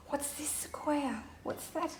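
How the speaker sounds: gasp, then hushed high whisper; breathless hush for a listening child; suspenseful whisper. Acted. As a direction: whisper as if something is lurking above. Hushed suspense before the tiger appears.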